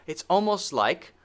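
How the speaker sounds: usual speaking voice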